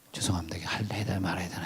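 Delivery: hushed, rushed and breathless aside; barely audible, hurrying the words out; whispered mumble. Retold or imitated. Preacher retelling his timid answer to the cursing scammer, whispering fast.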